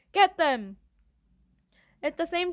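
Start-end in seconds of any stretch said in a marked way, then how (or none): 0.1-0.8 loud, raised-pitch shout; screamed command. A narrator's reading. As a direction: scream it like an order to attack. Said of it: "someone else screamed".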